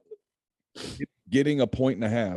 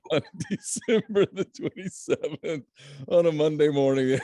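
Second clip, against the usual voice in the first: talking through laughter; laughing; 5 semitones higher than usual. Real, not acted. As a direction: chuckle your way through the words. Cracking up at early kickoff.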